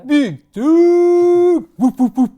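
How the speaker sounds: high, loud hype whoops; whooping shout. Retold or imitated. Host mimics colleague's morning catchphrase, loud whoops. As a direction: whoop like a crowd.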